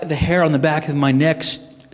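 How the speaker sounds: usual speaking voice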